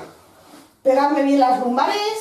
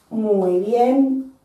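usual speaking voice

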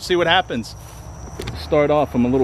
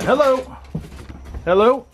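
nervous hail to whoever might be there; calling out; 12 semitones higher than usual. Real, not acted. Calling out at a noise.

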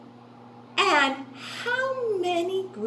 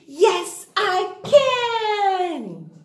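higher, louder rallying chant; bigger cheer; 7 semitones higher, 5 dB louder, much slower than usual. Shown as a demonstration. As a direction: pump the class up with it. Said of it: "kids chant their affirmation".

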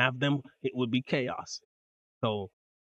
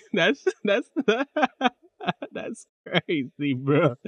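laughing disbelief; 6 semitones higher, 5 dB louder, slower than usual. Real, not acted.

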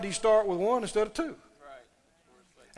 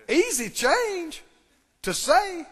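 mock breezy claim; 8 semitones higher, 4 dB louder, slower than usual. Mocking an easy claim.

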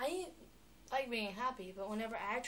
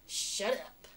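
louder, mock-irritated shush; annoyed retort; 7 dB louder than usual. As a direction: imitate someone fed up with the noise. People snapping at her, acted.

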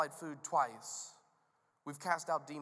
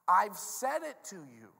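loud, measured rebuke climbing in pitch; frustrated teacher at wit's end; exasperated Jesus; 4 semitones higher, 5 dB louder than usual. Acted. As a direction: build the case point by point. Jesus' exasperated speech continues.

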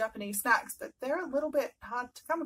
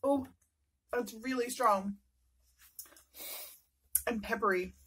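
chewing between words; speaking while still eating; mouth full of noodles. Real, not acted. Seen 1: Lips closed and working on food after a big noodle mouthful.

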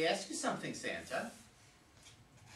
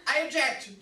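loud objection; 5 semitones higher, 8 dB louder than usual. Acted. Sparky objects loudly.

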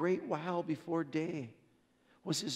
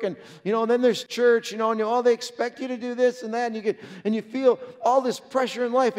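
loud, driving preacher's build through the list; preaching at full force; 5 semitones higher, 11 dB louder than usual. Acted. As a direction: pile it on, louder and louder, like a pastor pressing the point.

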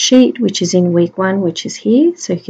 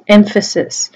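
normal talking voice

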